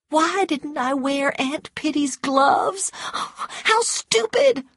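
very high, slow wail of exasperation; exasperated self-reproach. A narrator's reading. Scarlett thinking of Aunt Pitty's gloves, exasperated. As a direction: scold yourself in a high, drawn-out voice. Scarlett privately berates herself.

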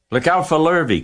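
high, loud warning; cows calling out. A narrator's reading. Cows warn fleeing Wilbur, calling out. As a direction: call a warning, pitched well up. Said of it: "called the cows".

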